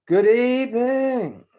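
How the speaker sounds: slow, loud, high, grand announcer greeting; mock announcer. Greeting viewers as a pompous TV host, slow and grand.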